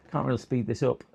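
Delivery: normal talking voice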